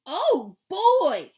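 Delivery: big, high-pitched booming Papa Bear; loud booming voice. A narrator's reading. Papa Bear exclaiming to the family, loud booming voice. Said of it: "said Papa Bear in his loud, booming voice".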